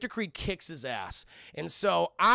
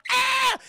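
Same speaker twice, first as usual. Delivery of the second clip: retold scream; 9 dB louder than usual. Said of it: "Logan hears the".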